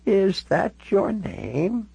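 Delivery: put-on high, very slow elderly question; very old woman. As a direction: ask it like a frail old lady. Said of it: "She looked all of ninety-six years".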